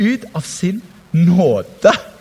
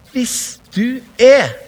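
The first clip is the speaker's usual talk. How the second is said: each word drawn out very slowly; slowly stressed mocking challenge; slow, stressed quote; 4 semitones higher, much slower than usual. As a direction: lean hard on the doubt in it. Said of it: "sier han".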